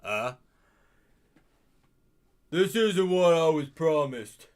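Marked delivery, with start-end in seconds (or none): 2.5-4.5 a touch of frat guy, a little louder. Acted. Streamer's first try at the detective comes out as the frat guy voice. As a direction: slip into the frat guy by mistake. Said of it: "that's the other frat guy's voice".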